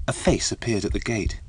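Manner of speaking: ordinary delivery, nothing marked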